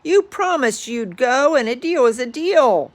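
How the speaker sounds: pushy bug scolding the elephant; bossy bug. Acted. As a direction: nag like a pushy bug holding him to a bargain. Quagga bug bossing Horton.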